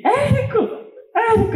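calling a name high and thrilled; yelling Érico, thrilled; excited calling out. Retold or imitated. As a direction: shout his name in delight. Excitedly calling out after big sales.

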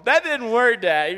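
very loud, high mock wail; upset daughter. Daughter reporting back to Dad, loudly upset. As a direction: imitate a kid hollering in frustration.

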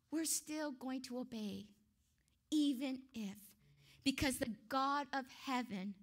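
ordinary talk

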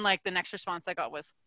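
ordinary talk, nothing marked

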